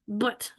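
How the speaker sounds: But with the open English carat vowel, a short uh sound. Shown as a demonstration. Teacher demonstrating the English vowel in But to students, soft. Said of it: "qui est dans but".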